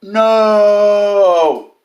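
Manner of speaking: high, loud, drawn-out mock cry of no; mock anguished cry. Mocking a grieving friend's post with a loud hammed-up no. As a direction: wail the no like hammed-up grief. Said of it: "capital N".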